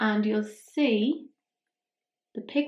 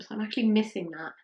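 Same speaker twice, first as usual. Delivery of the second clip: usual speaking voice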